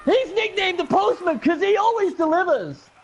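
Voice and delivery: high, thrilled touchdown cheer; thrilled celebration. Real, not acted. Celebrating his goblin's touchdown with a friend, thrilled. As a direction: let the joy spill over.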